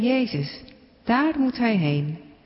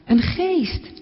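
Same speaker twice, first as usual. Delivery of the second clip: frightened cry; 8 semitones higher than usual. Acted. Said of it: "Nog een keer roept iemand".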